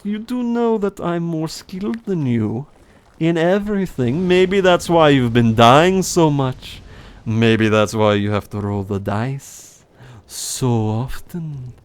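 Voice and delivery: put-on boastful German-accented character voice; Heinrich. German character taunts Gunslinger about skill, accented and drawn out. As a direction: needle the listener in a hammy German accent. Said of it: "my old German voice".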